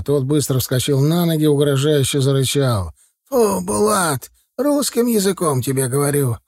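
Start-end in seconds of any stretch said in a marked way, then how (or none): 3.3-6.4 put-on old man scolding his dog; dog owner's shout. A narrator's reading. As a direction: command the dog high, then scold it. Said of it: "пёс под криком своего хозяина".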